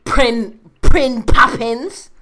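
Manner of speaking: high, loud shrill-voiced evil king; Joffrey voice. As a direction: sneer it like a spoiled boy king. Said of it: "You sounded like a witch when you said that".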